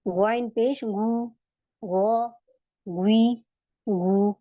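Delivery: गौ, गुई, गु with thick throaty gh; heavy ghain drill. Shown as a demonstration. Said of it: "मुझे इसे हैवी बनाना है".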